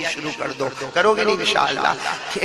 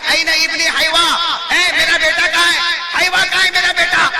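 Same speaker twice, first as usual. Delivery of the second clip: a hint of a confused old mother searching the crowd; old mother calling; 9 semitones higher, 7 dB louder than usual. Old mother searches the gathering for her son, loud high call.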